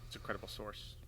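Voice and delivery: hushed mock-serious whisper. Friend whispers mock approval of the source. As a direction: breathe it like a secret.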